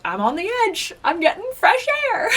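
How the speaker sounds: retold upset. Getting upset with adrenaline on the edge, mock-upset protest. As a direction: act out a worked-up, squealed protest. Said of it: "'cause I was getting upset".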